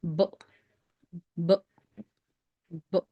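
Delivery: B cut off at once, not stretched; stop sound. Shown as a demonstration. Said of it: "It's a stop sound".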